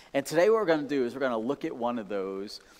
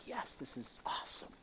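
breathy thrill; 14 dB quieter than usual. Retold or imitated. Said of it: "you're just like".

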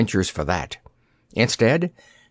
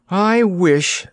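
sighed; 8 semitones higher than usual. A narrator's reading. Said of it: "sighed Dorothy at last".

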